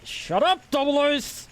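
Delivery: high-pitched mock yell; playful shout. Real, not acted. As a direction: snap it out high and sharp. Yelling at friend in chat.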